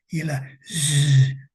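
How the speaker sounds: "z" alargada y zumbante, muy lenta; z zumbada. Shown as a demonstration. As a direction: estira la "z" como el zumbido de una abeja. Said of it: "como el sonido de una abeja". ("z" con zumbido largo, como abeja)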